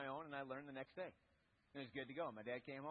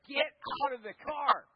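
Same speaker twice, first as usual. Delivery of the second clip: much louder, higher angry yell; furious dad shout; 8 semitones higher, 14 dB louder than usual. Retold or imitated. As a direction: bark it like a fed-up dad. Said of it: "my dad was like".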